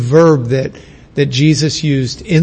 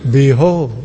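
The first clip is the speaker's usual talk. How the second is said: mock solemn behold; 4 semitones lower than usual. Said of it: "it's not like".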